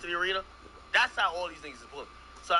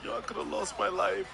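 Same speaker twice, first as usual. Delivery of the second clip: imitated crying plea, slightly raised pitch; mock tearful; 4 semitones higher than usual. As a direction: put on a mild tearful lament. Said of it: "And he's crying".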